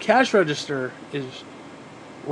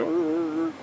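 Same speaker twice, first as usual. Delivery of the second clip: growl; 11 semitones higher, 5 dB quieter than usual. Retold or imitated. Dale T comes running.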